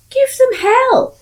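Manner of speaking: loud, high battle cry; rousing shout. Real, not acted. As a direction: egg them on, loud and slow. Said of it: "Don't be such a snowflake".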